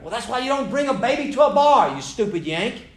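shrill high, loud mock-scolding New Zealander; hammed-up rude bar patron; mock angry Kiwi woman. Rude bar woman insulting him.

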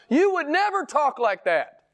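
high-pitched preacher's reproof; impassioned rebuke. Real, not acted. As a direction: drive the rebuke home way up in pitch. Climax of rebuke, then repeated low.

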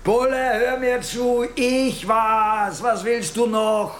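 high, heated and defiant; angry insistence. Acted. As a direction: throw the confession at the policeman. Suspect insists on his confession.

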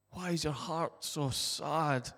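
soft, quiet, tender questioning; gentle angel. A narrator's reading. The angel asks weeping Mary, soft and tender. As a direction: ask gently as a caring angel. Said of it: "The angel says".

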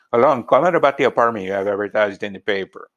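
quick phone inquiry in Mexican American English; fast, connected Chicano English; Chicano dialect. Shown as a demonstration. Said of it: "a Mexican American dialect".

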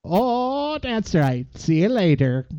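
lilting Canadian farewell; Canadian accent. Acted. Closing the podcast in character, Canadian accent. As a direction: wave them off in a Canadian accent. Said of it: "for a Canadian listener".

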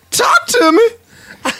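very high, slow squeal; drawn-out high-pitched hungry cry; Hungry Dude. Acted. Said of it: "now Hungry Dude is".

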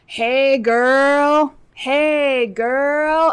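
put-on loud high catcall, hey girl; drawn-out creepy catcall; catcalling yell. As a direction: call out across the street at passing girls. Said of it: "he keeps, like, yelling at us".

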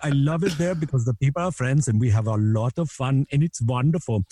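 lilting comic Indian accent. Shown as a demonstration. Comic loving the friendly people in India, Indian accent. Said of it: "Then I go to India".

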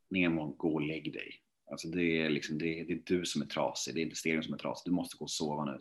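low, quiet deadpan reply; unimpressed partner, softly putting someone to bed; dismissive girlfriend. Retold or imitated. Girlfriend's weary reply to his panic.

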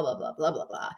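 mock grumbling under the breath; whispered mock complaint. Coach mimics her old complaining in a whisper. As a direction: trail off into a whispered blah blah.

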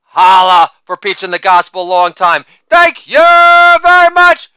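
shouted catchphrase. Acted. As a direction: belt the sign-off like a showman milking applause.

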